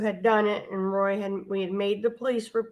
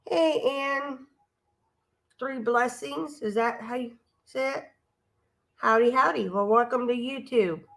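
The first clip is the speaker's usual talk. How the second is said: dog's voice; 3 semitones higher than usual. Pretend. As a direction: speak as the friendly dog. Voicing the dog to the man.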